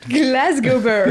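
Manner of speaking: loud, drawn-out mock Glaswegian; slow hammed-up Glasgow accent. Teasing the co-host's fake Scottish, loud Glasgow accent. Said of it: "I'm actually from Scotland".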